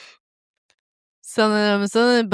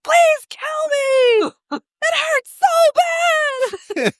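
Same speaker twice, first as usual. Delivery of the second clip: mock Chip in pain; much slower than usual. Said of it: "he's just like".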